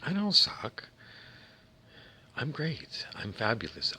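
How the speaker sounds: high, indignant protest; defensive. Acted. Defensive denial after being called lousy.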